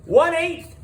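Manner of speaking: high, loud eager shout of the answer; yelled answer. A narrator's reading. Pupil yelling the answer to the clown teacher, loud and high. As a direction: blurt it out like a keen pupil. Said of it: "yelled Alessia".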